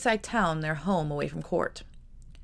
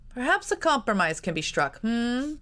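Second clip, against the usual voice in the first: testing; 6 semitones higher than usual. A narrator's reading. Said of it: "Richard tested".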